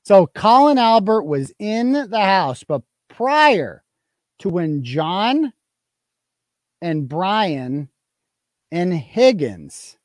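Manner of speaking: slow, words spaced with pauses; slow emphatic replay. Shown as a demonstration. Blogger replaying the key line for viewers, slow and deliberate. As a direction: lay each name out slowly. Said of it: "and then she stops herself".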